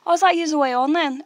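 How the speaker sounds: bright, loud and high Northern Irish ask; friendly goodbye question to a guy leaving; chirpy retold question. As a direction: toss it out cheerily to a new friend. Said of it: "and I was like".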